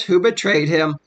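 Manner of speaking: ordinary delivery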